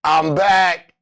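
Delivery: ordinary talk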